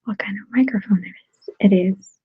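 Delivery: ordinary delivery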